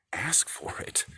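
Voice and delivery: a breathy whispered dare. Real, not acted. Daring the viewer to ask, whispered. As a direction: hush it like a secret.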